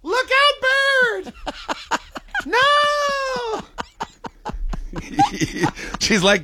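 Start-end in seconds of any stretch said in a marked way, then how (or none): 0.0-3.6 much higher, panicked squeal; squeaky high-pitched yell. Retold or imitated. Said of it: "your voice could hit those notes". 3.7-6.0 breathy falsetto giggle; high squeaky laugh. Retold or imitated. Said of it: "hit those notes".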